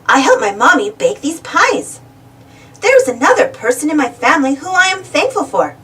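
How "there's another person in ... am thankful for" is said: plain reading voice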